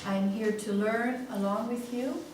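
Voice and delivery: usual speaking voice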